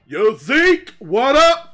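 loud, high bro yell across the room; big muscular jock shouting hello; jock greeting shout. Acted. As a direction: hail your buddy at full volume. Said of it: "is just like".

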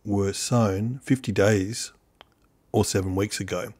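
low, soft and measured documentary read; quiet recorded how-to voiceover; voiceover narration. Acted. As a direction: calmly narrate the steps for the viewer.

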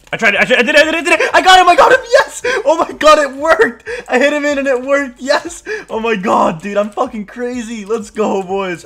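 screaming high and loud with triumph, laughing; frat bro. Real, not acted. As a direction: yell like you just won the game. Trap worked, screaming celebration.

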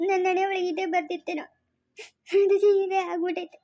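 crying, resigned to fate; tearful lament. Acted. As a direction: sob over your bad luck. Sister laments her fate, crying.

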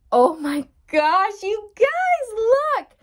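high, loud gasp of excitement; amazed. Real, not acted. Reader amazed by a card to viewers, high excited exclaim. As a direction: shriek at the cards in disbelief. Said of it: "is your mind totally blown".

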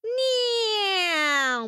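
high squeaky cat meow. A narrator's reading. Storyteller making a cat noise, high meow.

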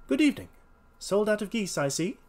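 high, loud, genial greeting; genial detective. Acted. Holmes greets the salesman.

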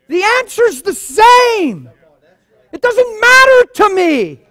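preaching. Acted. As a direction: build it up to a full pulpit roar. Preaching builds to full force.

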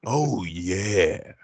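drawn-out mascot catchphrase; Kool-Aid man. Acted. Podcaster goofing on old commercials, drawn-out mascot impression. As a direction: stretch it out like a cartoon mascot. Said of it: "Sound like the Kool-Aid man".

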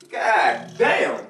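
ordinary talk, nothing marked

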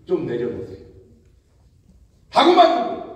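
usual speaking voice

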